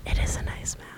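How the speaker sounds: hushed, voiceless whisper. Real, not acted. Co-host admiring an actor's mouth, whispering. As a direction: whisper it like a private admission. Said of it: "You whispering that?".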